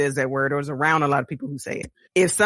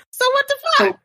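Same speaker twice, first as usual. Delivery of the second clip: much higher, loud disbelief; exasperated outburst; 4 dB louder than usual. Real, not acted. Ending the story about her date, exasperated outburst. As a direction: throw up your hands in disbelief.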